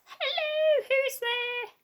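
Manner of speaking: high, falsetto granny voice; a wolf squeaking up like an old lady; the wolf pretending to be Grandma at the door; wolf faking Grandma. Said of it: "pretended to be Grandma".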